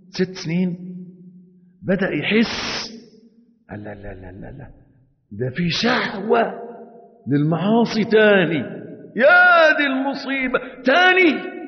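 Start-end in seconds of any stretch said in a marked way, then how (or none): 3.7-4.7 a near-silent repeated no, barely voiced; hushed inner no. Inner voice whispering no. 5.3-11.6 rising, slowed panic, higher and louder; dismay building to a cry; alarmed. Acted. Man alarmed at his own desire.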